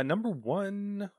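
high, slow goofy announcer; silly announcer voice. Pretend. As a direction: drag out the number like a game show. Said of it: "read things like an idiot".

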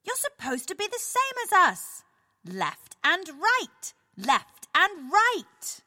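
scolding. Acted. Scolding penguin insists on rules.

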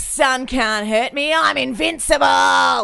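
put-on slow, high, shouting tough old swimmer; aussie tradesman. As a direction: bellow it like a sun-baked bloke.

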